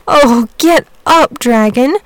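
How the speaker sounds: put-on high, slow pleading boy's cry; piteous boy. A narrator's reading. Tired boy pleading with sleeping dragon, piteous high cry. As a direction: beg him piteously to get up. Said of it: "cried the boy piteously".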